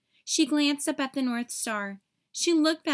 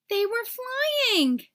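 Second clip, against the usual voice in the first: slow, high and louder, full of wonder; excited; 11 semitones higher, 4 dB louder, much slower than usual. A narrator's reading. Narrator revealing the reindeer is flying, slow awed excitement. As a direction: let the thrill burst out slowly.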